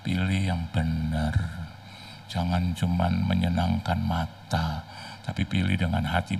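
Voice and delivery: low, slow counsel; fatherly advice. Retold or imitated. Father advising his daughter, low slow counsel. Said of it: "bapaknya juga suka bilang".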